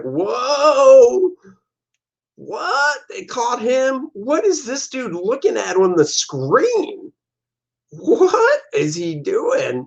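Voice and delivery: high, slow, baffled guy; startled voice. Acted. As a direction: gasp it out, drawn-out and high. Character startled by the screen.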